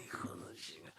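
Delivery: unvoiced whispered insult under the breath; whispered curse. Dad whispering a curse at his son. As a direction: hiss it as a mocking aside. Dad mocking son's dream.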